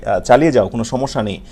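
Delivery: ordinary talk, nothing marked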